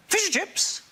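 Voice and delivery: high, thrilled Welsh dad cry; excited dad. Retold or imitated. A dad thrilled about fish and chips for dinner, excited squeal. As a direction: squeal it like an overexcited working-class dad. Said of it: "will go".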